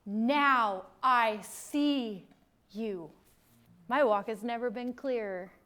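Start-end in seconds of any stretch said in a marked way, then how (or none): 0.1-3.1 slow revelation. Retold or imitated. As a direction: let the realisation land word by word. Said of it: "and you say".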